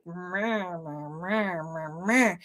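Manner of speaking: low, flat mock grumble; bored meh. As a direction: imitate a bored crowd going meh. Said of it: "everybody's so, like".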